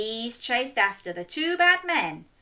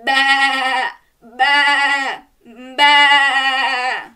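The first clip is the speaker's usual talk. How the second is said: loud, drawn-out higher bleats; sheep baa; 4 semitones higher, 9 dB louder, much slower than usual. Shown as a demonstration. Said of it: "Can you baa like a sheep?".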